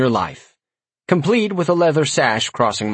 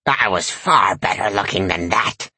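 put-on cackling old lady; 3 semitones lower than usual. A narrator's reading. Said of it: "the old lady cackled".

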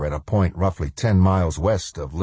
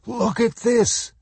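alarmed; slower than usual. Acted. Lombard alarmed at radar.

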